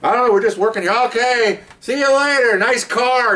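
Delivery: put-on high, singled-out drunken hollering; high-pitched mock-drunk farewell; obnoxious drunk act. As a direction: play up a cheery drunk brushing strangers off. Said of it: "really loud and obnoxious".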